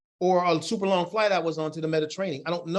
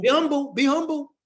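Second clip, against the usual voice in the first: parent voice; 8 semitones higher, 4 dB louder than usual. Retold or imitated. Parental saying repeated, voiced higher.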